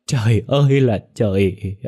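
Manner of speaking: Trời ơi là trời wailed loud and slow; wailing cry. Acted. Mother wails to the heavens, Trời ơi là trời loud and slow. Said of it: "mà gào lên".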